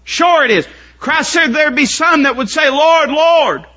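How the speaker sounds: high, loud pulpit delivery; fervent pulpit preaching; preaching at full force. Acted. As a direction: preach it hard.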